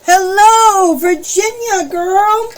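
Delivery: high, loud, drawn-out hello; bright sing-song welcome; excited greeting. Real, not acted. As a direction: call out warmly to a viewer. Greeting a viewer on livestream.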